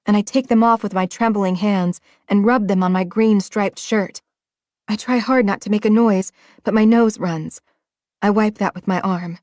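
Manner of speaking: ordinary delivery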